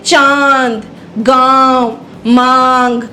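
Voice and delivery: चांद, गांव, मांग with a long aa through nose and mouth; slow word-by-word चांद, गांव, मांग, vowels nasalised. Shown as a demonstration. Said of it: "हवा नाक और मुंह दोनों से टकराकर".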